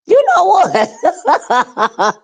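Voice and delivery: high laughing voice. Real, not acted. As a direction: laugh it out brightly. Laughs at the comment.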